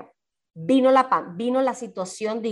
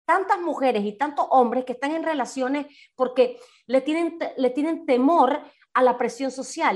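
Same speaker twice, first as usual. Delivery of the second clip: ordinary talk, nothing marked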